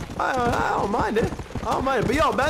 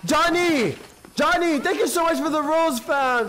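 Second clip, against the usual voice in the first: excited shout-out; 9 semitones higher, slower than usual. Real, not acted. Thanking a donor excitedly.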